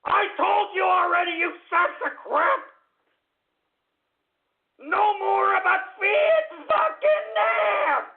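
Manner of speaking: high-pitched, enraged shout; furious outburst. Real, not acted. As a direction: scream at them, stretching the final words. Host explodes at the trolls.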